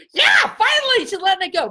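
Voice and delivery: cheering. Retold or imitated. Grandparents cheer as Danielle lets go, high excited shout. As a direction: cheer it out like a relieved crowd. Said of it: "They're like".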